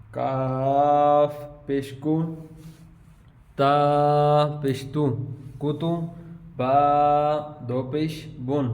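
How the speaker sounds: slow, drawn-out syllable-by-syllable spelling; slow spelling drill. Shown as a demonstration. Teacher spells out the Qaida lesson for students, slow and drawn out. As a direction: sound out each letter clearly for the pupils.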